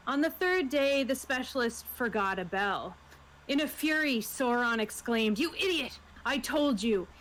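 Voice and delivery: usual speaking voice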